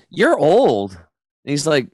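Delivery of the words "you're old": put-on high, loud disbelieving "you're old"; a son amazed his old dad outran him; incredulous son. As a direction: sound stunned at your father. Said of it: "he goes".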